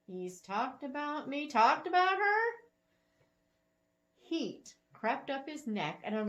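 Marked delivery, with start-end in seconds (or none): 1.5-2.5 louder and higher on "her?"; surprised. Acted. Bethany stunned she was spoken of, stress on "her". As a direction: stress on "her", rising high and loud. Blinked at the woman's next words.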